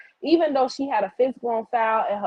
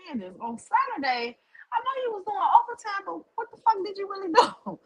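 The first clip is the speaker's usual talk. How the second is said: lightly voiced mock chatter, higher and fast; 12 semitones higher, 4 dB quieter than usual. To her chat, imitating nosy viewers in a high mock voice. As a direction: tease them in a high, quick put-on voice. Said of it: "them people don't be like".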